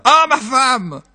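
high-pitched husband's exclamation; sudden high outcry; crying out. Acted. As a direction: cry out to the wife. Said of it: "Et le mari s'écria".

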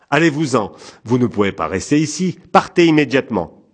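firm, heated command to leave; angry dismissal. A narrator's reading. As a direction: send the intruder away, loud and high. Man orders stranger off his porch.

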